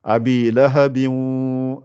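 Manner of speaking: very slow abi lahabin, nasal held two beats; showing the two counts; nasal hold. Shown as a demonstration. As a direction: let lahabi ring through the nose. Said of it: "sort par le nez".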